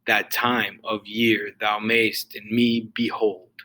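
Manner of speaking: slow reading, stress on "time", "year", "mayst", "me", "hold"; iambic stress. Shown as a demonstration. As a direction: lean on every second syllable of "That time of year thou mayst in me behold". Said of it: "read it out loud ... the accented sound".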